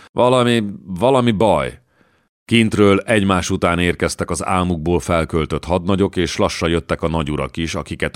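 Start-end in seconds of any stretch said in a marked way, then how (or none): none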